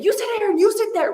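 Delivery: put-on high-pitched exasperated bossing at the kids; high, scolding mock yell; mock parental yell. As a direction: imitate a fed-up mother yelling, without full volume. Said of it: "yelling at them and saying".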